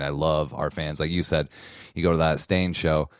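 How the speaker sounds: normal talking voice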